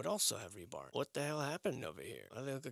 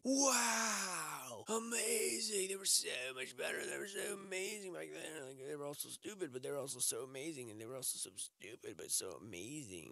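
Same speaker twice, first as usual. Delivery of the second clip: higher, airy, awestruck mock tourist; gushing tourist impression; 4 semitones higher, 4 dB quieter than usual. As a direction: ham up a dazzled sightseer. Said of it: "and then they say".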